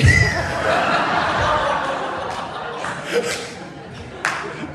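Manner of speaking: long, high wheezing laugh; real helpless laughter. Real, not acted. Speaker cracks up at his own story, helpless laughter. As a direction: lose it completely, breathless laughing. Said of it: "I'm laughing my head off".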